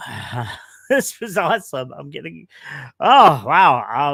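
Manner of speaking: tearful laugh into a big exhale; overcome with emotion. Real, not acted. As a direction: choke up, then let out a loud "wow". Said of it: "I'm actually starting to cry".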